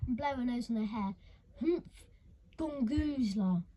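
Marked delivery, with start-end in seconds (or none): 1.6-3.6 contemptuous snort. Acted. Curdle blowing nose on hair.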